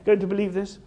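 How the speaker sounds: high, playful, knowing reply; wry amusement. Acted. Jesus answering his excited disciples, wry and amused. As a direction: tease them with a knowing smile. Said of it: "And Jesus is like".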